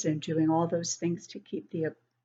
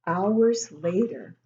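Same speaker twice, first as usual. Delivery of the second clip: ordinary delivery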